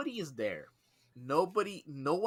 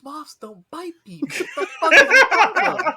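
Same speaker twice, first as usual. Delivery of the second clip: high, loud laughing outburst; laughing incredulous shout; 17 dB louder than usual. Retold or imitated. Telling coworker moths don't bite, laughing shout. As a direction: yell it while cracking up. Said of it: "I was like".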